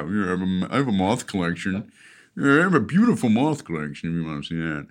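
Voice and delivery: lightly voiced creepy serial killer. To his brother, a hint of a creepy killer showing off moths. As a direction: purr it out like a creepy collector of moths.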